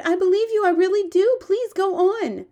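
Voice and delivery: high, loud, urgent reassurance; pleading cry. Acted. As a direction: beg him earnestly to continue. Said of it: "Sophie cried".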